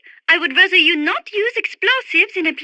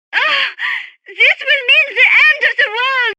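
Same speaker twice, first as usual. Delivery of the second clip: high, loud cry of alarm; alarmed dread; 8 semitones higher, 4 dB louder than usual. Acted. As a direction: cry out as if doom is coming. Alarm at impending catastrophe.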